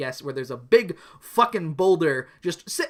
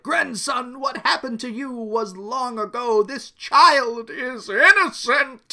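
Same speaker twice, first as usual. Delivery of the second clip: solemn elder; 9 semitones higher, 7 dB louder, slower than usual. Acted. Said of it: "Johan is basically like".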